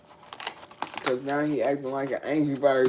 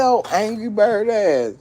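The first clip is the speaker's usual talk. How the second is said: mock yell; 7 semitones higher, 8 dB louder than usual. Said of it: "That's why I said".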